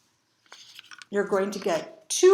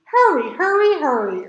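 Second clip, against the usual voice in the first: urgent; 4 semitones higher, 6 dB louder than usual. Acted. Bear urging Mole to hurry, urgent and loud. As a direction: Bear pressing to hurry, louder. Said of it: "urged Bear".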